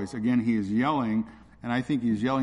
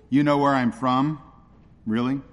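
ironic questioning; 5 dB louder than usual. Acted. Jesus challenges the crowd on where he comes from, ironically. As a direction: draw out the question in ironic disbelief. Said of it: "Jesus is speaking ironically".

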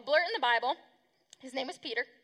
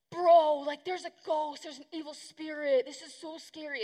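mock freaking out; 3 semitones higher than usual. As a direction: play spooked disciples, lightly hammed-up. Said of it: "And they're freaking out".

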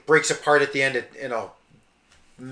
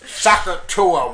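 Phyllis Diller impression; 5 semitones higher, 6 dB louder, slower than usual. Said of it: "laughing sketch that Phyllis Diller".

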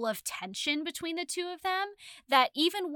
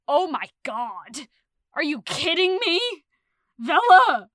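excited outburst; 3 semitones higher, 9 dB louder than usual. Retold or imitated. Retold moment of reading Vella.

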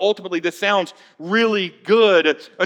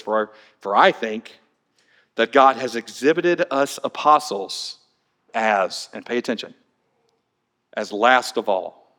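grave; 7 semitones lower than usual. Acted. Pastor rereading Paul to the church, low and solemn. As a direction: let each phrase land like a sentence of death. Grave reading after cold reality.

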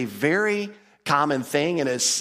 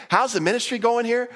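curious visitor; 9 semitones higher than usual. Retold or imitated. Visitor asks Laodicea pastor.

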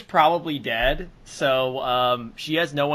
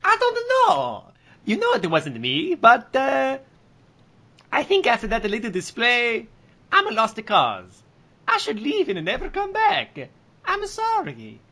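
high character voice; 8 semitones higher than usual. Acted. Character voice apologizing dramatically.

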